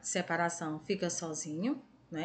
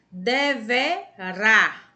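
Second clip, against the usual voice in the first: deverá loud and slow, each syllable apart; 5 dB louder, much slower than usual. Shown as a demonstration. Teacher helping pupils find the stress in deverá, syllable by syllable. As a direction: sound out deverá firmly, piece by piece. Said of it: "pronunciou com mais intensidade".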